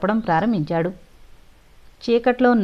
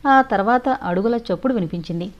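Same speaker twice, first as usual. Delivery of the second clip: ordinary delivery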